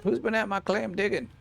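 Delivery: high, playful huffy protest; mock indignant. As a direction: pretend outrage for the baby. Mock indignation over claim.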